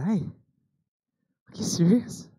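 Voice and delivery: very slow, drawn-out disbelief; stunned disbelief. Pretend. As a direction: let it sink in slowly. Son's stunned reply to parents.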